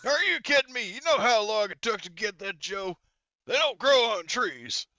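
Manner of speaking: high, loud exasperated outburst; indignant refusal. Acted. Boss scolds a nagging underling over wasting a working Joe, indignant.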